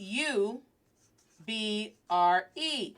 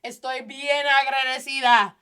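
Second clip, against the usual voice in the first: higher, louder heartfelt repeat; emphatic thanks; 4 semitones higher, 7 dB louder than usual. Shown as a demonstration. Scene: grateful for fast-food past, emphatic Spanish repeat. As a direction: say it again with more feeling. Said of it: "I'm so thankful".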